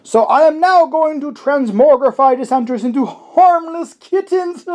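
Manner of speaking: high, far villain overlord voice; booming arrogant alien overlord. Shown as a demonstration. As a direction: read it like a gloating overlord. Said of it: "in your best overlord voice".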